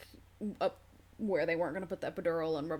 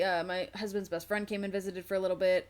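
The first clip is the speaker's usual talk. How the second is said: usual speaking voice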